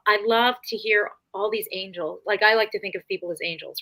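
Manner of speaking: usual speaking voice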